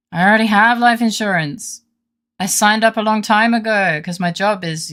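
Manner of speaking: high, loud surfer dude. Acted. Surfer client turns down life insurance, high loud dude voice. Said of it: "Oh, no, he's a surfer".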